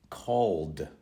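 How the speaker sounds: CALLED in one syllable closing on d; plain d ending. Shown as a demonstration. Teacher contrasting three endings, d ending. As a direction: say CALLED with only a d after the l. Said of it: "I pronounce the E D differently".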